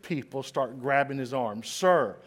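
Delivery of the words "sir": normal talking voice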